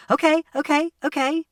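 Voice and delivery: put-on a woman calming a boy who screamed at her touch; soothing. A narrator's reading. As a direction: back off gently and calm him.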